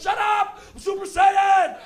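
loud, high defiant shout; kid yelling back. Retold or imitated. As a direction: imitate a child screaming at mom. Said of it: "Why are you yelling".

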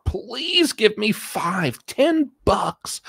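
high, slow whiny pleading; mock begging. Host imitating a broke man begging for cash, high whine. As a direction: beg in a high stretched whine. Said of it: "beg and plead".

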